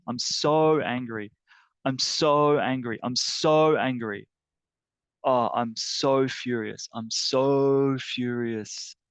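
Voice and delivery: drawn-out, emphatic SO in each angry burst; slow, heavy venting of anger; angry, furious. Shown as a demonstration. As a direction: vent fury, dragging out "so". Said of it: "my emotional reaction is anger".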